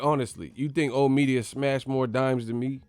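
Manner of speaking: ordinary talk, nothing marked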